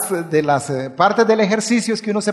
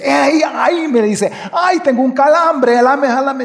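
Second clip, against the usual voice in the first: panicked cry for help; 6 semitones higher than usual. Retold or imitated. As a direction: yell for help as a cramp grabs you. Drowning friend calls for help.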